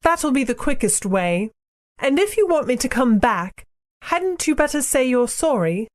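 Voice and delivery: put-on high girl's voice, rather cool; high-pitched, aloof little girl; cold Polly. A narrator's reading. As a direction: demand it coolly in a high child character voice. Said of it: "said Polly rather coldly".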